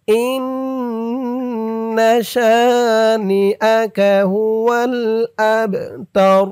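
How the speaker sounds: slow melodic tajweed chant; Quran recitation. Shown as a demonstration. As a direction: draw out every vowel in recitation. Recites Quran verse slowly.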